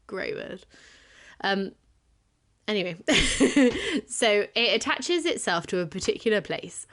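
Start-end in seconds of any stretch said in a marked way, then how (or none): 3.0-4.0 laughing. Real, not acted.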